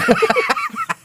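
very high giggle squeal; squealing laugh. Real, not acted.

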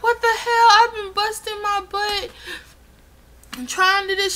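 high, loud exasperated cry; exasperated outburst. Retold or imitated. Arguing with the nurse, high loud exasperated cry. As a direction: throw up your hands in disbelief. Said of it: "I'm like".

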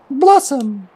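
very high squeaky little creature. Acted. Player voicing a new game character, squeaky voice. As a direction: squeak it out in a tiny high pitch. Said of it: "You gotta give it a squeaky voice".

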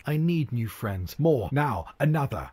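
demanding cry. Acted. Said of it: "The prince cried out".

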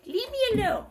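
a smaller, high-voiced echo of the complaint; mock protest. Imitating what she said, high toned-down mock protest. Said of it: "She said".

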